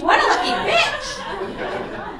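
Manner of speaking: high, loud, drawn-out shriek; envious exclamation. Retold or imitated. Comic reacting to young girl's inheritance, shrieked envy.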